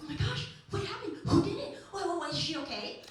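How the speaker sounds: high, loud panicked gush; mock teacher flapping over the news; teacher freaking out. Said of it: "our teacher starts freaking out".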